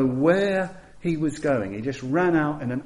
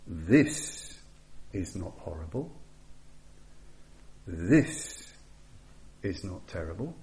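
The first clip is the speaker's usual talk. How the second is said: calm voice of the Buddha; 5 semitones lower, 8 dB quieter than usual. Retold or imitated. The Buddha calmly reassures the panicked Yasha, soft and low. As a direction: let the words settle gently like a calm master. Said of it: "a voice said".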